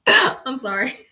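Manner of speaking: sorry through giggles; can't stop laughing while apologizing; laughing apology. Real, not acted. Laughing at the question.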